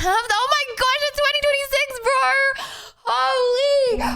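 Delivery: high, loud disbelieving cry; exasperated shout. Real, not acted. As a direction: yell it in disbelief. Stream breaks, streamer shouts.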